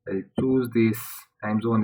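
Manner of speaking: ordinary talk, nothing marked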